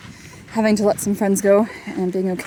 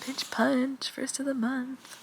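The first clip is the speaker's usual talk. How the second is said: whispering; 5 semitones higher, 7 dB quieter, slower than usual. Real, not acted. Whispered in tent.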